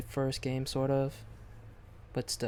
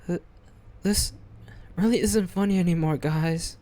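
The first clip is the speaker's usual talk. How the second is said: louder, rushed, raised-pitch plea; upset protest; 3 semitones higher, 4 dB louder than usual. Acted. Girl telling her friends the prank isn't funny, upset protest. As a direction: protest loudly, voice pushed up.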